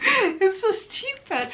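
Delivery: laughing at herself, high and slow. Real, not acted. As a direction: giggle through it, embarrassed at the silly name. Laughs at own project name.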